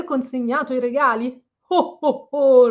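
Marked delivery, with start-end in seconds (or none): 1.7-2.7 high, loud jolly Santa laugh; a booming playful ho-ho-ho; Santa's ho ho ho. Acted. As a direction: laugh warmly like Father Christmas. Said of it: "rise lui".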